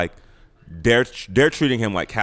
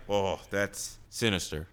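ominous hush; 11 dB quieter, slower than usual. Real, not acted. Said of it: "It's sinister".

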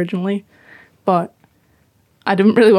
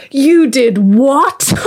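mock disbelief; 3 semitones higher, 3 dB louder, much slower than usual. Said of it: "she was like".

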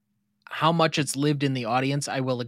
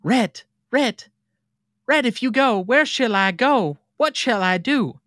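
high, pleading Southern belle; pleading Scarlett; 9 semitones higher, 3 dB louder than usual. Acted. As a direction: beg him not to leave, voice raised. Reading Scarlett's pleading movie line.

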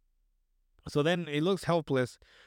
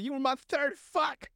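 high-pitched, gleeful taunt; high mocking squeal; 11 semitones higher than usual. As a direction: squeal it out with glee. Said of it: "And then Mike's like".

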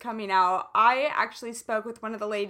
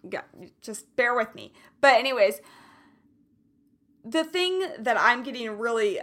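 usual speaking voice

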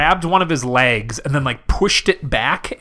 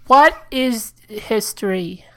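halting, clueless question; dim caller; 8 semitones higher than usual. Pretend. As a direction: ask it haltingly as a baffled caller. Caller asks dumb question.

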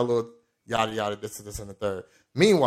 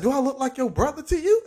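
angry sergeant; 14 semitones higher than usual. Retold or imitated. Angry sergeant confronting recruit.

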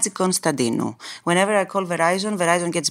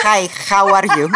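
louder, laughing hello; playful hello breaking into laughter; laughing greeting; 2 semitones higher, 7 dB louder than usual. Real, not acted. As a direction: greet them through laughter. Hams up her teased accent, laughing.

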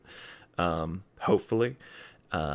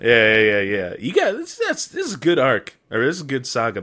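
awkward joy; 4 semitones higher, 7 dB louder than usual. Real, not acted. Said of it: "Sorry, I'm just excited".

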